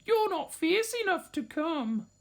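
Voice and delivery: high, mocking little voice; scornful sisters. A narrator's reading. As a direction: sneer it down at him from up high. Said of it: "told him".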